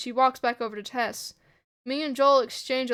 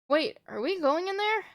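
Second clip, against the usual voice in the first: higher-pitched nervous question; mildly worried, raised voice; 7 semitones higher than usual. Acted. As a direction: ask it nervously, pitch climbing.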